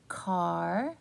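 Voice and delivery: ordinary delivery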